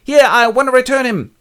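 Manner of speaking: high, loud put-on nurse voice; nurse complaining. Nurse demands Père Terrier take the baby back. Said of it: "Père Terrier goes".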